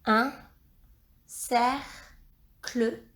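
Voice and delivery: "cercle" split in two, cer, pause, cle; syllable by syllable. Shown as a demonstration. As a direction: break "cercle" into slow separate syllables. Rereading a dictated word for pupils.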